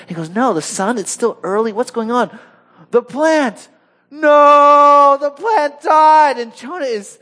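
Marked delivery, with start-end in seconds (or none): 0.3-2.4 lightly acted, higher-pitched bewilderment; mock alarm. Preacher as Jonah waking to the heat, mildly hammed-up bewilderment. 2.9-6.4 high, loud, hammed-up cry of dismay; upset wail. Preacher as Jonah discovering his dead plant, melodramatic upset shout. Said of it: "really upset about the plant".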